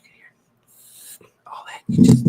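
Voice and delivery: voiceless whisper close to the mic; mock ASMR whisper. Shown as a demonstration. Streamer demonstrating silly decant ASMR to viewers, mocking whisper. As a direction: whisper it, making fun of ASMR creators. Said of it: "Just whispering".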